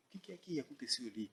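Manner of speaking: retold in a very soft undertone; hushed question. Sanches quietly asks about the man by the car, hushed. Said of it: "falou baixo".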